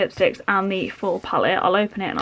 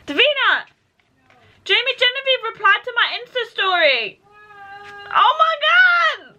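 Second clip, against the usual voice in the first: high, loud thrilled shout to her sister; real squealing excitement; excited shouting; 13 semitones higher, 5 dB louder than usual. Real, not acted. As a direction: yell the news across the house. Celebrity replied, shouting to sister.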